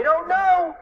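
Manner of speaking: high, loud, drawn-out reply; baffled cry. A narrator's reading. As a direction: stretch it high in bewilderment. Baffled reply in voiced story.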